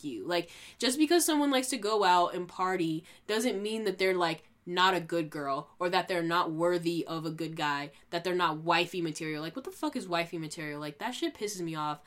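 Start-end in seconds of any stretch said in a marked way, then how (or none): none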